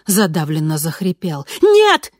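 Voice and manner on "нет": sudden high scream; shout. A narrator's reading. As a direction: cry out to stop him. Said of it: "крикнула я".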